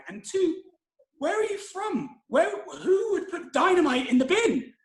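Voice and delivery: high, incredulous mock outrage; shocked disbelief. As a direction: react in disbelief, pitch climbing. Shocked at dynamite confession.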